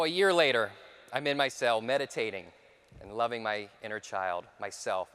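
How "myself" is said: usual speaking voice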